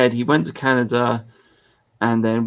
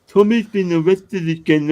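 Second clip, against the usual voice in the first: high mock fan, pushed louder; 8 semitones higher, 6 dB louder than usual. Streamer mocking fans with a louder sneer. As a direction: repeat it louder, more sneering. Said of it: "and then people say".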